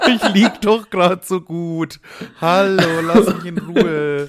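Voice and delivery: mock drunk. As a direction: ham up a sleepy drunk. Friend mimics the drunk man.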